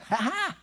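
quiet high "ha ha" from the back; soft mocking laugh. Kids in the back mocking the lady. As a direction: copy her under your breath. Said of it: "we would all start going".

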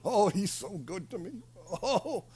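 low, soft, feeble devotion; mock hospital patient. Sick person in hospital professing love for the Lord, mock hospital patient. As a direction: imitate a weak patient, fading into low sighs. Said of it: "they're laying in the hospital".

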